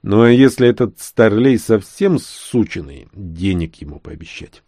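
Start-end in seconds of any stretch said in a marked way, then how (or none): none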